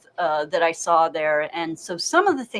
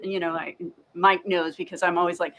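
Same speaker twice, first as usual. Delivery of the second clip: usual speaking voice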